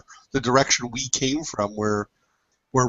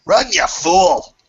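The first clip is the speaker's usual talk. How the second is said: loud, higher, drawn-out shout; raised warning cry; urgent yell; 5 semitones higher, 5 dB louder than usual. Acted. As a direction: holler it slowly to a fleeing friend. Character yells at a companion to flee.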